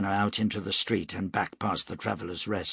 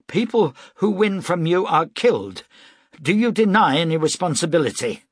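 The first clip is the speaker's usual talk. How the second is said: angry confrontation; 8 semitones higher, 6 dB louder than usual. A narrator's reading. Broom confronting Weber.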